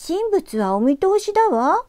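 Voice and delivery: high, loud prim rebuke; prim girl's rebuke. Acted. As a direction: scold him like a proper young lady. ユキが男の子をたしなめる. (たしなめる少女の声)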